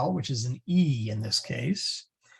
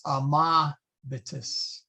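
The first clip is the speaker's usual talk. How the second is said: amabitis said slowly, a-MAA, bitis; accent on second syllable; slower than usual. Shown as a demonstration. Teacher applying the same rule, amabitis accented on MA. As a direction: amabitis with MA drawn out before the ending. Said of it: "Same thing, these are short".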